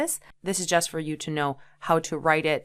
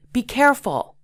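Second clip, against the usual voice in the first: "Be careful" higher and urgent; with great emotion; 7 semitones higher, 4 dB louder than usual. Shown as a demonstration. Teacher showing an urgent warning to a friend, higher pitch. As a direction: warn them urgently. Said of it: "excitement in your voice".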